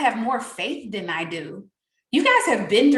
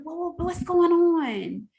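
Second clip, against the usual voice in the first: mock nosy question; 7 semitones higher, much slower than usual. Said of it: "the first thing".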